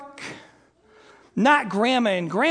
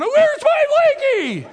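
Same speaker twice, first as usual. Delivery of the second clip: put-on wailing child; 14 semitones higher, 7 dB louder than usual. Child missing a favorite blanket, loud acted wailing. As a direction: wail like an inconsolable kid. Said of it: "she is wailing".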